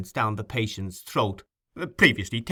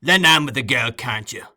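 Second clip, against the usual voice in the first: louder impatient bark; 2 semitones higher, 7 dB louder than usual. A narrator's reading. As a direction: demand help impatiently. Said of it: "said Sykes impatiently".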